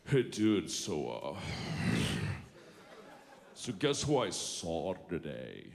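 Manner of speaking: low, sluggish dude voice; words sliding out slow and low; slack-lipped guy. Retold or imitated. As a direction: imitate a guy too lazy to move his lips. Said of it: "that's how he said it. It just slid out".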